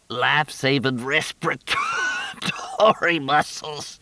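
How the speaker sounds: slow, laughing through the words. Acted. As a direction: chuckle while coughing. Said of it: "Marie coughed".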